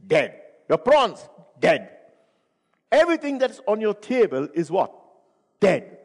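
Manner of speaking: dead hit loud and short each time; punched word. Acted. Preacher hammering the word dead at listeners. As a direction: slam down on every dead.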